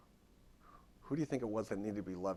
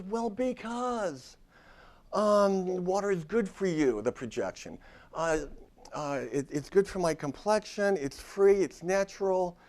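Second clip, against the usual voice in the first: imitating preachy client; 9 semitones higher, 9 dB louder, slower than usual. Client preaching about water.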